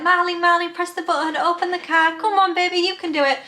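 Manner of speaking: put-on high, coaxing sing-song to a toddler; coaxing mum at the window. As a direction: sweet-talk the little boy into opening up. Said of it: "giving him all this".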